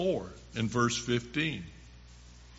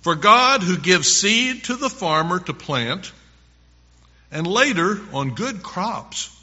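higher, louder, animated Bible reading; scripture reading; 4 semitones higher, 4 dB louder than usual. Acted. Preacher reads Paul on the cheerful giver, emphatic scripture reading. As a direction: proclaim the promise with punched key words.

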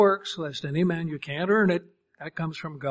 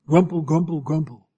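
sour grumbling; 2 semitones lower, 6 dB louder than usual. Pretend. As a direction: grumble like a bunch of sourpusses. Mimics grumbling sour pusses.

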